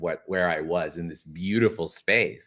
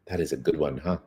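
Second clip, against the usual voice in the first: normal talking voice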